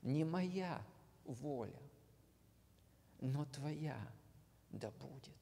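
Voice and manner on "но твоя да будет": usual speaking voice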